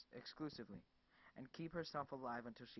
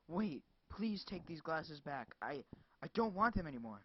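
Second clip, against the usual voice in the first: desperate plea; 4 semitones higher, 6 dB louder than usual. Acted. Desperate pleading.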